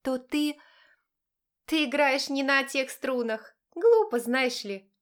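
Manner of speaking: slow, scornful and amused; mocking smirk. A narrator's reading. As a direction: sneer it slowly. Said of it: "с усмешкой прокомментировала Галина".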